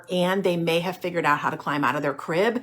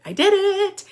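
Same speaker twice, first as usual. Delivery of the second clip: much higher, louder burst of triumph; thrilled parent cheering; excited squeal; 13 semitones higher, 4 dB louder than usual. Retold or imitated. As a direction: squeal it in delight. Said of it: "you're gonna be like".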